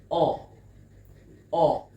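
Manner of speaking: "oh" as a loud, isolated short-U "uh"; short U sound. Shown as a demonstration. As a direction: exaggerate the "oh" vowel as in umbrella. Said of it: "I kinda turn up my mouth".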